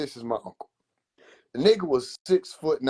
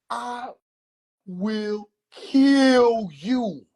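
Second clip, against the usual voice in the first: slow, drawn-out threat, pitched up; menacing uncle; 6 semitones higher, much slower than usual. Retold or imitated. Uncle threatening his nephew, slow menacing warning. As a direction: stretch "Omari" out, then slowly promise to kill. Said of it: "He say".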